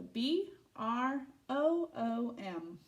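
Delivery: letters spelled out slowly one by one; slow spelling. Shown as a demonstration. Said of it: "spelling letter by letter".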